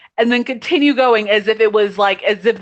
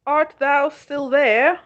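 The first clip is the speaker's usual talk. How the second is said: slow, drawn-out mock-archaic query; playful thee-and-thou call into a dead line; mock Shakespearean; 4 semitones higher, much slower than usual. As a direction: ham it up like an old stage actor calling out. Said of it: "mock archaic while line breaks".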